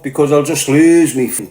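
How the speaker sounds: ordinary delivery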